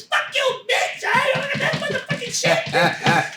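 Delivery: high, furious yelling actor; angry yell. Retold or imitated. Actor quitting the show yells at the director. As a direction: yell it as you storm out of the show. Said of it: "all I hear is".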